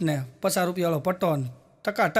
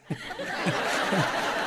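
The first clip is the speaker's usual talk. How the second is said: laugh; 4 semitones lower, 6 dB quieter than usual. Real, not acted. Laughs after punchline.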